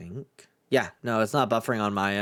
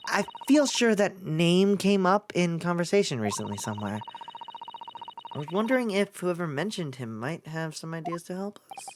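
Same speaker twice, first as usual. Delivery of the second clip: higher, lilting polite voice; polite assistant; 5 semitones higher than usual. Acted. Assistant hinting who might help, polite higher voice. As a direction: muse gently, pitched up.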